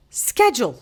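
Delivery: "schedule" with a SK start, American style. Retold or imitated. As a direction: say "schedule" as SKED-jool. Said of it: "she adopted this American accent".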